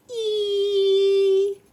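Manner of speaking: a long loud high sung ee; high singing note. A narrator's reading. Flutes falling from sleigh, high sung note. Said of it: "the musical note".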